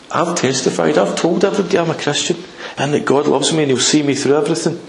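ordinary talk, nothing marked

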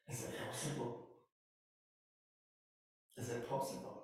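hushed disbelief. Acted. Widow stares at wee basin.

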